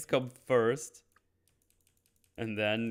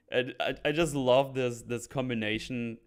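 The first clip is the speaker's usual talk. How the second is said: ordinary talk, nothing marked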